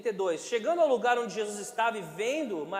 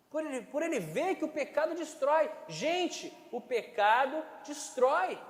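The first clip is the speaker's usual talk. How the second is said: fervent preaching; 5 semitones higher than usual. Acted. Emphatic preaching about sin.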